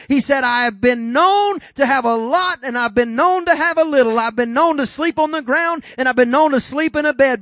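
loud, high, rhythmic preacher's cry; preacher raising the roof, quoting Paul; preaching at full force. Real, not acted. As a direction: drive it home to the congregation.

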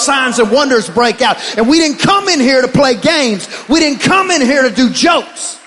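loud fervent preaching. Acted. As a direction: preach it hard to the congregation. Preaching at full force.

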